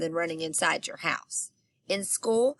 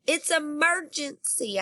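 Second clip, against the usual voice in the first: shouting; 8 semitones higher than usual. Acted. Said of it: "I shouted".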